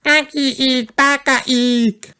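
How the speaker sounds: put-on a tiny boy shouting nonsense; toddler George squealing. A narrator's reading. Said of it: "shouted George".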